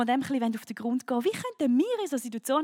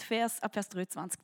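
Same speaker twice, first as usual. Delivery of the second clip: ordinary delivery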